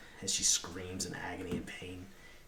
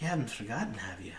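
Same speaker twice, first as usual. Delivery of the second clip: higher, louder figure voice with a questioning lift; woman character speaking to partner; 4 semitones higher, 4 dB louder than usual. Acted. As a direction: play her higher and emphatic. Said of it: "She's like".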